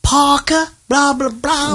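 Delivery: high-pitched Southern drawl parody; mock Southern belle accent. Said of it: "but she's not doing the".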